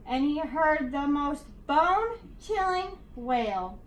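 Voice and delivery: ordinary delivery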